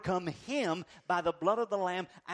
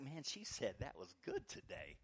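dreamy self-quote; 5 semitones lower, 13 dB quieter than usual. Retold or imitated. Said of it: "It's like".

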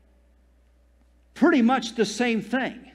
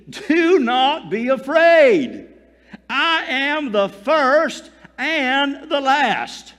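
slow, drawn-out, louder reassurance; reassuring Jesus; 5 semitones higher, 4 dB louder, much slower than usual. Acted. Jesus comforts John fallen at his feet, slow and reassuring. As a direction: lift him up slowly, every word weighted.

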